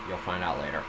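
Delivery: ordinary delivery, nothing marked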